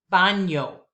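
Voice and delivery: "baño" said with a clear "nyo" middle, BAH-nyo. Shown as a demonstration. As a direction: stress "baño" on the first syllable with ñ as "ny". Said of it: "squiggly line over the N".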